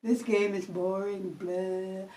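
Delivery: low, soft, slow bored drone; mock-bored sigh of a voice. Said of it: "not just sitting there".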